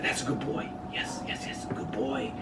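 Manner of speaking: put-on whispered good boy praise to a dog; soft praise. Shown as a demonstration. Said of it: "watch your voice".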